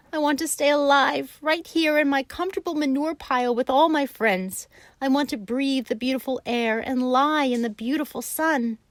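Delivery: high, loud tearful pleading; weeping pig. A narrator's reading. Wilbur weeps about staying alive, high and loud. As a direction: cry out the longing.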